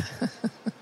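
brief, breathy chuckle; a quick little laugh to yourself; short laugh. Real, not acted. Preacher after asking the congregation if they'll get it, short chuckle.

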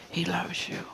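soft, half-whispered reassurance; hushed assurance. Real, not acted. Preacher telling each listener of the Father's love, hushed.

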